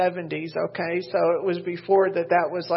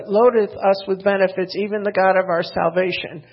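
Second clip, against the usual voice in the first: louder, measured Bible reading; scripture reading; 6 dB louder than usual. Acted. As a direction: proclaim the verses to the church. Reads scripture aloud to congregation.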